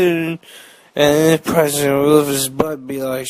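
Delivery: ordinary talk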